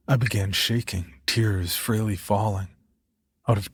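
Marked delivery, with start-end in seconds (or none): none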